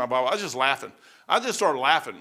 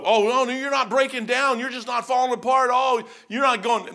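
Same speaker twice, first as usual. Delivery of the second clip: mock scolding critics; 7 semitones higher, 3 dB louder than usual. Mocking critics telling him off.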